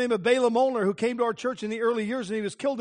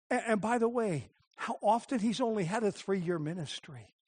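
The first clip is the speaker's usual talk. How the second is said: normal talking voice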